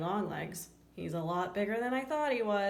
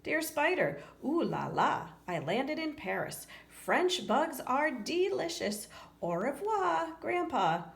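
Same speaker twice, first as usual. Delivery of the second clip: a hint of a jaunty grandpa, higher and slow on French bugs; reading Grandpa's postcard; 6 semitones higher than usual. Reading Grandpa's postcard from Paris, lightly voiced. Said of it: "I got a postcard from Grandpa".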